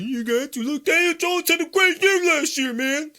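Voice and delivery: very high, loud whiny fan voice; mocking caricature of protesting supporters. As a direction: squeal it like an indignant, hammed-up fan. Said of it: "Everyone says".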